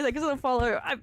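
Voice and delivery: ordinary talk, nothing marked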